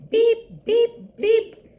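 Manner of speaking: high, loud, separate truck beeps; urgent little horn toots; distress beeps. A narrator's reading. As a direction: sound the horn for help. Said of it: "cried the little blue truck".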